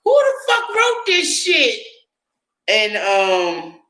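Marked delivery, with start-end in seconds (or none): 0.0-1.8 shrieking high with a laugh, slow then rising; laughing disbelief. Retold or imitated. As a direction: cackle in outraged disbelief. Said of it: "I was like".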